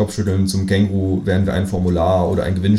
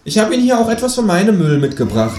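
high, mock-polite kangaroo; high-pitched talking-animal courtesy; 13 semitones higher, 4 dB louder than usual. A narrator's reading. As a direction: thank brightly in a high creature voice. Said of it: "sagt es".

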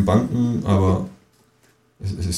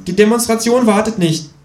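high cheeky kangaroo; 12 semitones higher, 4 dB louder than usual. Acted. Kangaroo brushing off the complaining narrator, high and cheeky. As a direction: snap back high as the know-it-all kangaroo.

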